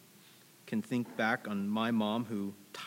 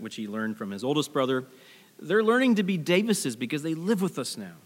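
ordinary delivery

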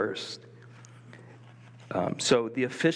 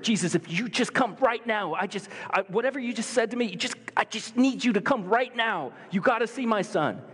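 high, pleading official begging Jesus; a father begging, pitched high and urgent; desperate pleading; 10 semitones higher than usual. Retold or imitated. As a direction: beg him to come right now, voice pitched up. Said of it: "he is so desperate".

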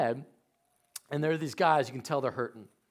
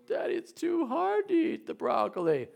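high-pitched mock kid whine; put-on squeaky child's complaint; whining child; 4 dB louder than usual. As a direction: plead like a little kid at dinner. Imitates child refusing vegetables.

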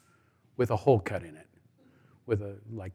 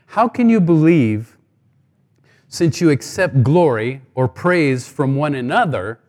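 scripture reading; 2 semitones higher, 7 dB louder than usual. Acted. Reads the verse to the congregation.

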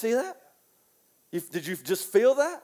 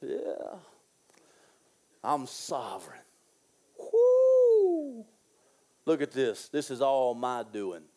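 boastful king; 8 semitones lower, slower than usual. Retold or imitated. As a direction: gloat like Nebuchadnezzar, lower. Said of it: "he's like".

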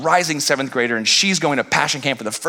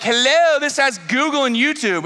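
high, pointed complaint; exasperated customer; 9 semitones higher than usual. Retold or imitated. Dad complaining to the Verizon clerk. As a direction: announce it with indignant disbelief. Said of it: "I'm like".

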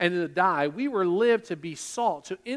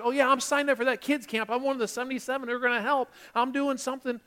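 higher, quick boastful voice; mock volunteer; 7 semitones higher, faster than usual. Preacher to congregation, imitating a busy volunteer, higher and fast. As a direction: rattle it off like a proud volunteer.

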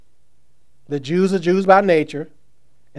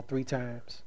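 normal talking voice

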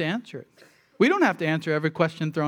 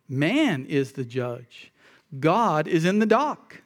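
Man is the judge, God is in the dock, spaced out slowly; slow preacher's contrast; slow emphatic contrast; slower than usual. Acted. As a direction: lay it out slowly word by word.

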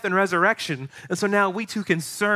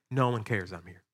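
much lower, softer self-pitying sigh; 7 semitones lower, 6 dB quieter than usual. Retold or imitated. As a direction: sulk that nobody noticed. Voicing self-pitying person.

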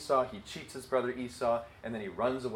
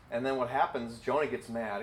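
usual speaking voice

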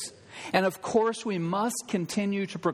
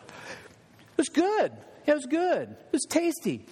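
lightly mock how people shrug good; mock casual review; 10 semitones higher than usual. Pastor mocking how people rate a burger, high mock-casual voice.

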